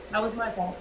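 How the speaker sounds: ordinary talk, nothing marked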